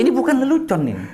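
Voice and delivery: high, loud protest; real insistence. Real, not acted. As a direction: insist over the laughter. Insists over listeners' laughter, not joking.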